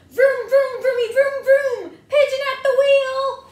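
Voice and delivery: high, loud vroom vroom; engine noises. Acted. Pigeon pretending to drive, loud high engine sounds. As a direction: rev it like a toy engine. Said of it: "Then the pigeon says".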